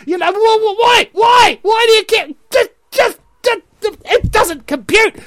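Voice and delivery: very high, stammering, strained shouting; exasperated outburst. Real, not acted. Commentator baffled by compliant public, exasperated shouting. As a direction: sputter it high, too worked up for words.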